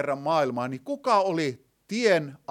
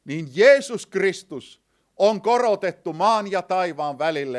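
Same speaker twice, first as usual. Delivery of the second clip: ordinary talk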